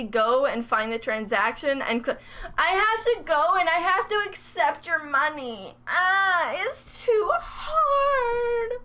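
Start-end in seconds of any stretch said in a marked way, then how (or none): 2.6-8.8 high tearful whine rising to a drawn-out wail; hammed-up crybaby complaint, dragging the last word; mock whining. Streamer whines about having to accept money, mock crying.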